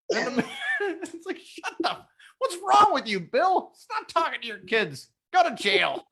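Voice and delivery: high, giggling disbelief; laughing outrage. Real, not acted. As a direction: scold Bill while laughing. Outraged laughing rant at Bill.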